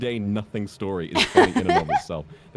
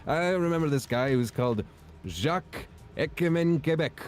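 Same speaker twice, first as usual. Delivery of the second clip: impersonated comedian's deadpan setup, pitched up; Norm Macdonald; 7 semitones higher than usual. Acted. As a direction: spin out a shaggy-dog tale, drawing out the name. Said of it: "it's his delivery that sells it".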